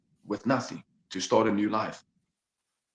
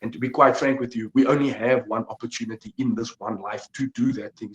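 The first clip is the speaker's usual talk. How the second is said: usual speaking voice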